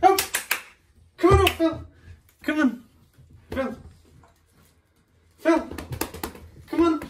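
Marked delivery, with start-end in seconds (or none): 1.2-7.0 high dog call. Real, not acted.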